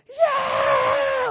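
imitating a child's high, loud cheer; long, high-pitched celebratory shriek; wildly excited. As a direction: scream a triumphant cheer like a thrilled kid. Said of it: "Like, he's so excited".

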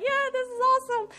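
overjoyed retelling; excited joy. Retold or imitated. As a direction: gush with delight. Retold joy after healing prayer.

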